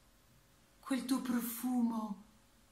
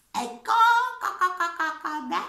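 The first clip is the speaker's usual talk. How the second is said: hen clucking; 10 semitones higher, 8 dB louder than usual. Acted.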